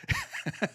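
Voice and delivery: breathy squeaky laugh; high-pitched laugh. Real, not acted. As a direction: giggle high and soft. Laughing at his own joke.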